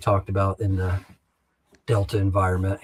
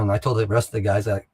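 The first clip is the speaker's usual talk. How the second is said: ordinary talk, nothing marked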